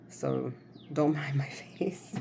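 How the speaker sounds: whispered with a giggle; laughing whisper. Real, not acted. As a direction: whisper it through a little laugh. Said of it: "I'm kinda swollen in my face".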